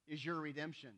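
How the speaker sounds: ordinary delivery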